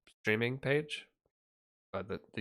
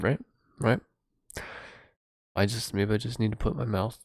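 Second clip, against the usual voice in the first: ordinary talk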